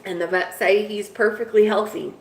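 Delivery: normal talking voice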